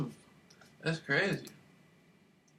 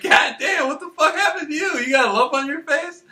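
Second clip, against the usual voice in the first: shocked exclaim; 7 semitones higher than usual. Retold or imitated. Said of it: "I'm like".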